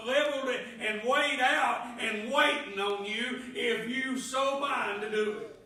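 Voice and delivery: loud, ringing preacher voice, pressing each line home; full-force preaching. Acted. Preacher testifying God's control to church, loud preaching. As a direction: preach it out, building to a shout.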